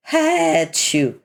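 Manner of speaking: high, sharp fake sneeze. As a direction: sneeze it out loud. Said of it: "My allergies".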